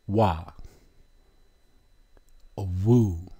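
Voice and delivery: "Wa." then "A Wu" sounded out as a letter name. Shown as a demonstration. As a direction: draw out "Wu" like an oo vowel. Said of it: "The second letter is a".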